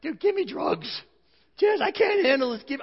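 high, loud, near-crying begging; mock desperate plea. Husband overwhelmed at the birth begs the nurses, high near-crying plea. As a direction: beg like you can't take another second. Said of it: "And I'm just like".

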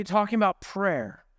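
ordinary talk, nothing marked

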